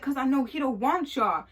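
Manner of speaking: smug insisting. Retold or imitated. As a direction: lay it on, sure of himself. Dude insists, mocking girls.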